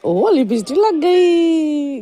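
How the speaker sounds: slow, loud and high surprised exclamation. Real, not acted. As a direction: draw out the shock of the lipstick smear. Surprise at baby's lipstick.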